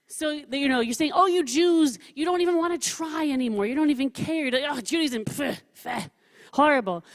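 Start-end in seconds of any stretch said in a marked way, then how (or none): 1.1-7.0 higher, louder exasperated prophet; mock scolding prophet. Teacher acting Jeremiah scolding his people, exasperated and higher. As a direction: sneer at them like a fed-up prophet.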